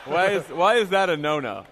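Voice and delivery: usual speaking voice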